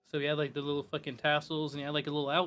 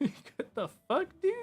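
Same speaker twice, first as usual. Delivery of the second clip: slow, higher through laughter; laughing disbelief; 12 semitones higher, 3 dB louder, slower than usual. Real, not acted. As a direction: laugh it out in shock.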